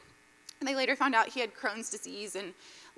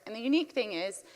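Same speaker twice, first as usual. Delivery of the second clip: ordinary talk, nothing marked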